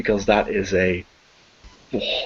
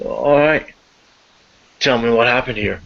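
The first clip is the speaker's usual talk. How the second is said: a lightly voiced gruff officer, a bit louder; cop questioning; 4 dB louder than usual. Officer questions the suspect, lightly voiced stern cop. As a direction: lean in like a tired cop asking questions.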